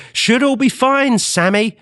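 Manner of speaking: high, slow sarcastic taunt. Acted. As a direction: sneer it sweetly, high up. Mocking Sam Altman directly.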